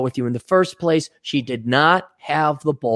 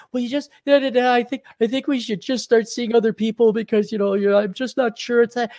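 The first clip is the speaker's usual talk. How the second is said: much higher, hesitant woman; mock girlfriend; 8 semitones higher than usual. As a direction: fumble the breakup like she did. Girlfriend's breakup line retold.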